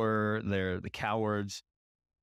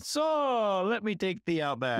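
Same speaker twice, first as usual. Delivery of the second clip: much higher, sudden syrupy switch after yelling; shopkeeper turning sweet; 10 semitones higher, much slower than usual. Retold or imitated. Podcaster quoting Watto inviting customers out back, syrupy. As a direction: flip straight into salesman charm. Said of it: "his next line is after yelling at Anakin".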